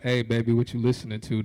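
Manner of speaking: low, smooth pickup line; the suave line he imagined; smooth in my head. Retold or imitated. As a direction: play it cool and low. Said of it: "That's what it sounded like in my head".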